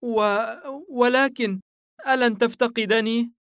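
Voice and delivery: put-on high child's voice, faltering start; faint-hearted little boy. A narrator's reading. Son under the horse asks father, plaintive child voice. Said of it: "وبصوت واهن يقول".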